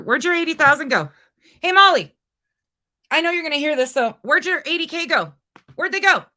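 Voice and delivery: high, loud demanding call-out. Real, not acted. Streamer loudly demanding Molly explain the missing money. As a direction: shout the question at her, high and loud.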